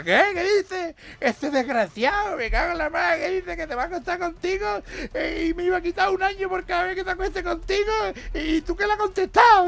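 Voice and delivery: high, indignant husband; mock outrage. Jailed husband outraged by the judge's offer, mock indignant. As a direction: splutter in put-on fury.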